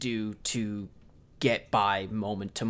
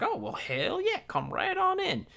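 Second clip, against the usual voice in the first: very high, sing-song welcoming voice; high falsetto mock welcome; mock sweet welcome. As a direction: chirp it like an eager hostess. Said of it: "she's like".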